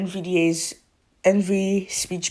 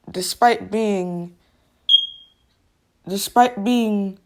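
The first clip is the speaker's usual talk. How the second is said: slow halting TTS pauses; 2 semitones higher, 4 dB louder, slower than usual. Shown as a demonstration. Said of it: "that's how it literally sounds".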